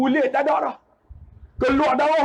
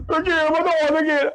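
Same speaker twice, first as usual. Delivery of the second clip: higher tearful retort; crying child; 4 semitones higher than usual. Retold or imitated. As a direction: play the hurt child wailing. Hurt child crying back at mother.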